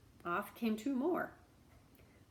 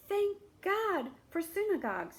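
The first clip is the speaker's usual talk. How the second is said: higher, louder thankful cry; relieved cry; 10 semitones higher, 5 dB louder than usual. Acted. Beggar finding the warm synagogue, relieved cry. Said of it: "he cried".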